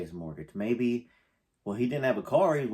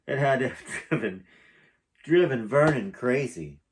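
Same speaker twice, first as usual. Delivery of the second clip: laughing; 3 semitones higher, 7 dB louder than usual. Real, not acted. Laughs through emotion.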